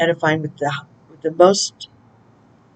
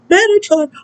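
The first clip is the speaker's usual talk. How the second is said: very high, loud mock summons; hammed-up high shout of a name; calling out; 6 dB louder than usual. Re-enacting calling the angel.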